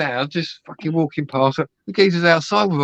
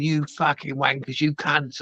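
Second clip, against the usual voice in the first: usual speaking voice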